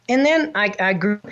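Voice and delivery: ordinary delivery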